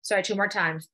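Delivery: ordinary delivery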